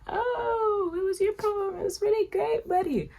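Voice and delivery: high, fake-sweet voice, mocking. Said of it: "And he's just like".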